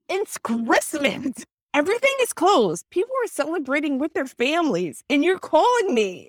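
high, emphatic mock outrage; exasperated. Publisher retelling a protest to a Christmas caller, exasperated.

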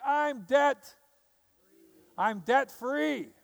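high, loud celebrating cry; joyful shout. Retold or imitated. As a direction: announce it with delight, stretched out. Rejoicing at being debt free.

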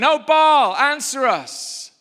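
high, loud, drawn-out plea; the prophets begging Baal to answer them, high loud wail; desperate cry to Baal. Acted. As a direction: call out to a silent god, slowly.

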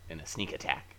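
normal talking voice

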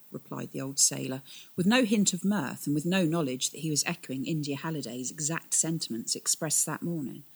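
usual speaking voice

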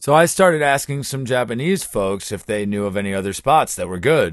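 ordinary delivery

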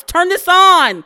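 loud, slow command to her own brain; drawn-out loud turn this on; loud urging. Real, not acted. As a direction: slap the brain awake. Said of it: "I'm slapping my brain".

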